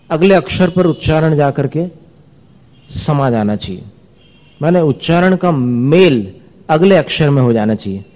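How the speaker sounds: ordinary talk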